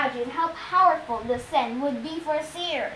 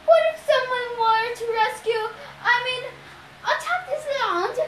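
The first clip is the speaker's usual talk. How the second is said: high, hesitant put-on female voice; nervous falsetto bride; 9 semitones higher than usual. A narrator's reading. Disguised captive fishes for security secrets, nervously high-voiced. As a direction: probe carefully in falsetto.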